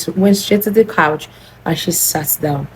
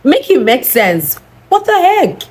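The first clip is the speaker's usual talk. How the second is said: disbelief; 8 semitones higher than usual. Real, not acted. Storyteller reacts to absurd demand.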